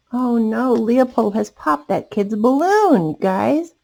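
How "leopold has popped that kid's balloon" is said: usual speaking voice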